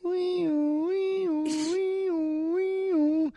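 high wailing wee-oo siren; steady looping siren noise; imitated siren. Mock siren over dad story.

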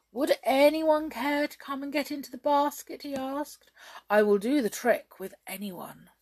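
high, grand offer; showman invitation. A narrator's reading. Hop inviting a volunteer, lightly grand.

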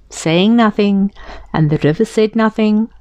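ordinary delivery, nothing marked

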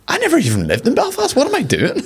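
high-pitched imitation of a Belfast accent; real Belfast accent. Podcaster catching himself mirroring a guest, real Belfast accent. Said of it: "talking real Belfast".

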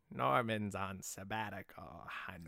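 lightly voiced Norman Osborn; Willem Dafoe voice. Trying a Willem Dafoe voice, low soft Norman. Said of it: "I want a Willem Dafoe voice".

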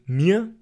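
"Mir" for Mühe, with an unrounded i-a glide; Bavarian form. Shown as a demonstration. Teacher giving the dialect word, i glide in "Mir". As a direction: say "Mir" with i opening at the end. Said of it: "im oberpfälzerischen Dialekt".

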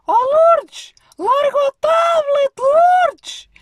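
loud, high shout across the hall; calling out. Retold or imitated. Calling to the doorwoman across the lobby, loud high shout. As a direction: yell her name to get her off the tablet.

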